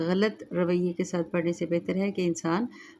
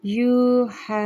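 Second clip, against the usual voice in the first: slow melodic tajweed chant; Quran recitation; 5 semitones higher, 6 dB louder, much slower than usual. Shown as a demonstration. Recites word.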